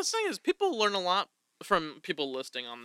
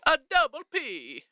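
put-on Italian accent; 7 semitones higher, slower than usual. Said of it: "I can't do an Italian accent".

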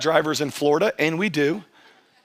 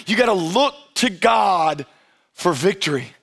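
usual speaking voice

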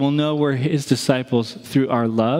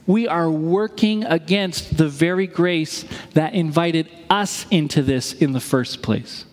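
ordinary talk, nothing marked

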